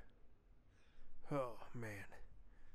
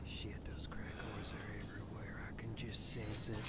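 whispering; 2 semitones lower, 10 dB quieter than usual. Real, not acted. Sneaking through a crack den.

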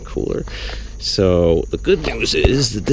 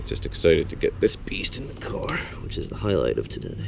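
soft excited whisper; 9 dB quieter than usual. Real, not acted. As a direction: whisper it like a secret thrill. Excited aside to camera.

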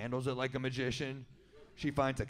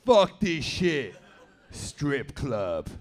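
louder, slow "Fuck this shit" then a curt "Strip club"; loud slow outburst; 8 dB louder, much slower than usual. Retold or imitated. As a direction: Stu dropping the woman, loudly fed up. Stu suddenly bored, demanding.

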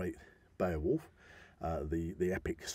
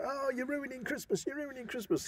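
high, soft whiny scold; mock nagging; 5 dB quieter than usual. Mocking people who complain about ruining Christmas. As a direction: whine it like a fussy relative. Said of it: "People say".

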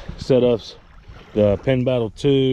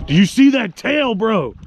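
excited; 8 semitones higher, 5 dB louder than usual. Real, not acted. Pointing out the tail.

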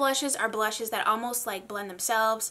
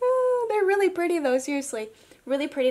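much higher and louder, admiring; delighted gush; 7 semitones higher, 5 dB louder than usual. Real, not acted. Vlogger admiring shimmer shades on her arm, delighted. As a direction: coo over the colors. Gushing over the colors.

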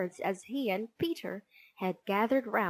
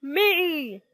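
loud, high eager me; screaming; 7 semitones higher, 7 dB louder than usual. A narrator's reading. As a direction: yell to be chosen over classmates. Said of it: "screamed Tough Toby".

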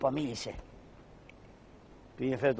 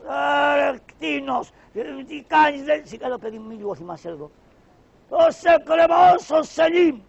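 high, loud furious shout; a high angry bellow, slow on the threat; furious pasha; 11 semitones higher, 9 dB louder than usual. Acted. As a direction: threaten the servant with hanging.

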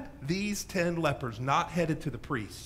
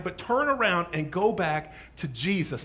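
ordinary talk

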